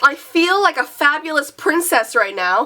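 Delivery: normal talking voice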